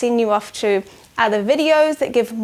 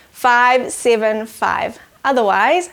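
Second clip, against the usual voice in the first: usual speaking voice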